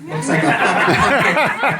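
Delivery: ordinary talk, nothing marked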